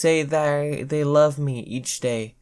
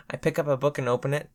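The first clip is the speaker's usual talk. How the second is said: usual speaking voice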